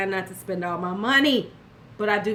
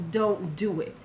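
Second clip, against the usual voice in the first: ordinary talk, nothing marked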